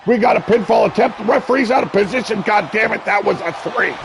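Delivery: high, loud, fast near-fall call; excited fast shout. Real, not acted. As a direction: rattle off the pin in a rush. Near-fall, referee too slow.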